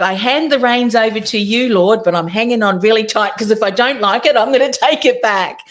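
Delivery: cling on while pretending to hand over; mock bargaining prayer. Believer praying to the Lord but hanging on tight, mock insistent.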